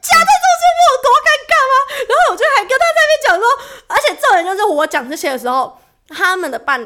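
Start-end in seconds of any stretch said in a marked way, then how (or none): 0.0-1.7 fast, high, loud rush of words; exasperated embarrassment. Real, not acted. To a friend, reliving being stuck between them, fast and high. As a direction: blurt it out, reliving the awkwardness.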